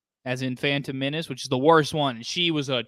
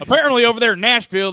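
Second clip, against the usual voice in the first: news anchor voice; 8 semitones higher, 8 dB louder, faster than usual. Pretend. Mock news report, fast, loud.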